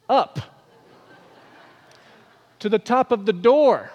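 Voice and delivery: normal talking voice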